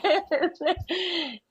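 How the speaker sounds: high nervous laugh; embarrassed chuckle. Real, not acted. Laughs at herself.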